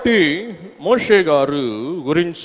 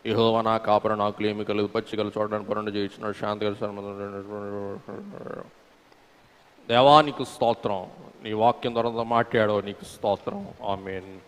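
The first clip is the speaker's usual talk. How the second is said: mumbled rote prayer, softer and rushed; mock hasty prayer; 6 semitones lower, 8 dB quieter than usual. Preacher mocking how ordinary believers pray, hurried and mumbled. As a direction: rattle off a careless prayer under your breath. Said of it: "మామూలోళ్ళు ఏం చేస్తారంటే".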